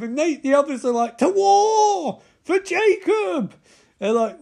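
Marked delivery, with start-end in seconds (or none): 1.2-3.5 high, drawn-out mock war yell; hammed-up rallying shout; battle cry. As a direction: bellow it as the answering horde. Acting the tribe's answering war cry.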